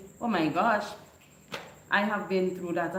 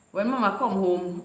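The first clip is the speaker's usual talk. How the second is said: ordinary delivery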